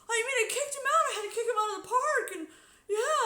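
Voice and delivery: high, loud laughing mock voice of the guard; imitating the guard. Streamer mimics the guard recounting the kick-out, high and loud. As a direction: squeal the guard's answer through a laugh. Retelling the guard's casual reply.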